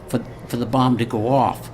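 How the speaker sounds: ordinary delivery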